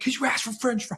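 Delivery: high-pitched mock exasperation; comic protesting dad; exasperated parent. Retold exasperated parent's reply to child.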